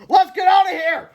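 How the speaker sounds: high, loud urgent yell; alarmed cry. A narrator's reading. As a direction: shout for a quick escape. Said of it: "he cried".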